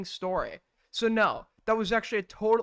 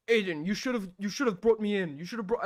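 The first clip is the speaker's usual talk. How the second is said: put-on Parry's accent. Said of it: "I can't do his accent".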